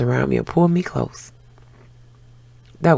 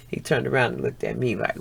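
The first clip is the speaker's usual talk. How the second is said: ordinary delivery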